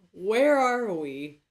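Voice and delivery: slow, loud dramatic questions; melodramatic confusion. Pretend. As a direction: overact being lost.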